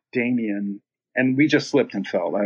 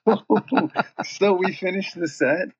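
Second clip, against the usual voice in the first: laughing; 8 semitones higher, slower than usual. Real, not acted. Still laughing after the story.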